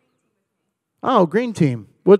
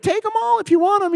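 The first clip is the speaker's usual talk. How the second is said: high eager dater; 11 semitones higher, faster than usual. Retold or imitated. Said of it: "You're like".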